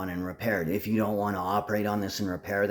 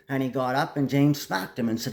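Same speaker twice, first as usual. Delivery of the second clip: usual speaking voice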